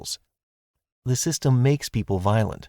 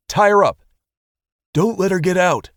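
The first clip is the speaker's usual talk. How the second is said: loud, high shouts at the team; shouted orders; 9 semitones higher, 7 dB louder than usual. A narrator's reading. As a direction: bark the orders mid-scuffle. Shouting orders during the capture.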